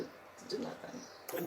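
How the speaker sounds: slow, whispered trailing off; exhausted whisper. Real, not acted. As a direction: let the breath run out on it.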